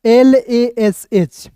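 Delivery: letters spelled out very slowly; slow letter spelling. Shown as a demonstration. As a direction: dictate each letter slowly. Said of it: "एफ जैसे दोस्तों यहाँ पे एफ". (धीरे-धीरे spelling)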